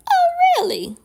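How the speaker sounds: loud falsetto "oh" dropping on "really"; exaggerated high swoop, ready to jump in; pointed "oh, really?". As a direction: mimic the neighbor, comically piqued. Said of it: "He was ready".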